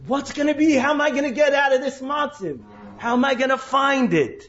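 anxious Yaakov. Retold or imitated.

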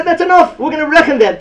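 high, emphatic declaration; the sages deciding it suffices, emphatic; acted sages' verdict. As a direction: decree it firmly as the sages. Chazal quoted as deciding.

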